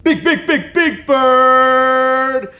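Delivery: loud, punchy announcer call; hype announcer. Acted. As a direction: pump up the crowd.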